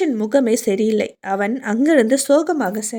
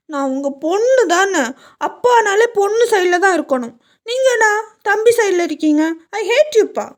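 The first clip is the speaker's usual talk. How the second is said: put-on higher, sulky whining girl voice; annoyed daughter; 7 semitones higher than usual. Daughter sulking at her father for siding with her brother, annoyed. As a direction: complain to dad like a spoiled daughter. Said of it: "Sanjana கொஞ்சம் காண்டாகி".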